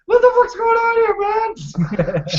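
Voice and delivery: high, squeaky granny voice; little old lady voice. Acted. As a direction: play a feisty old grandma. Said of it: "You use the same voice for the polar bears as the little old lady voice".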